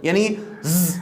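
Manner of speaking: usual speaking voice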